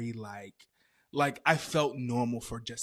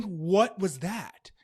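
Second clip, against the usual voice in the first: loud, higher, stretched-out question; alarmed disbelief; 5 semitones higher, 7 dB louder, much slower than usual. Retold or imitated. Storyteller reacting in bed to the strange return, alarmed. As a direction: blurt it slowly in mock alarm.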